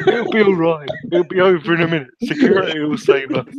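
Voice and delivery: put-on high, loud, hurried boyish voice; mock nervous reassurance. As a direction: imitate panicky lads comforting each other. Scared boys reassuring each other.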